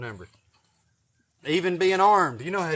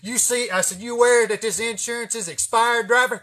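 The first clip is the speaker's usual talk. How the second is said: higher and louder bossy cop; prick cop voice; 7 semitones higher, 7 dB louder than usual. Retold or imitated. Pretending to be a cop, needling his buddy. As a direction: lean on the driver like a jerk cop. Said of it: "talking like a fucking prick motherfucker".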